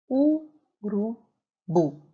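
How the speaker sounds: "Urubu" very slowly split into u, ru, bu; slow, spaced "Urubu" with stress on the last syllable. Shown as a demonstration. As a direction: draw out "Urubu" in separate syllables, ending on a firm bu. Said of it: "A sílaba mais forte é a última".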